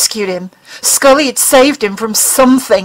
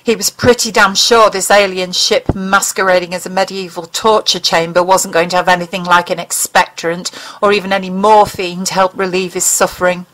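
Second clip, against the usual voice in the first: ordinary delivery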